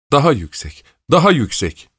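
high-pitched, insistent order; lightly acted yell, pitch jumping up; mild shouted command. A narrator's reading. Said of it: "diye bağırdı".

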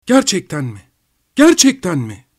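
high, eager repeated question; excited, relieved cry. A narrator's reading. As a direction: let excitement and relief burst out. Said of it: "bağırdı Pavel Pavlovich heyecanını ve rahatlamasını".

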